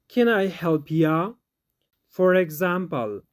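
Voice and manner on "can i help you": "Can I help ya?" with "you" reduced to a light "ya"; natural pronunciation. Shown as a demonstration. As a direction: say "help ya" relaxed, not "help you". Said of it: "Natural pronunciation".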